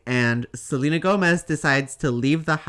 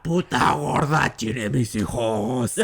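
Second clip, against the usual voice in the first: put-on growling old man voice; 2 semitones lower than usual. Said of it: "her old man voice".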